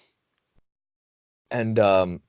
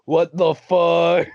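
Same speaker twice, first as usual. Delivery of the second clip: high, very drawn-out mock shock; stoned disbelief; 5 semitones higher, much slower than usual. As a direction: stretch it out in stunned disbelief. Shocked that two hours passed.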